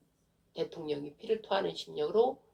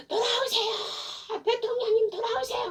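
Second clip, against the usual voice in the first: very high, sustained pleading call; imitated echoing cry; slower than usual. Crowd begging the president to return, imitated high wailing cry. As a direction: stretch it out like a crowd's echoing wail. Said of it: "메아리처럼 막 오는 게".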